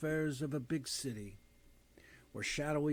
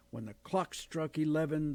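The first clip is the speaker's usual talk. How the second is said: ordinary delivery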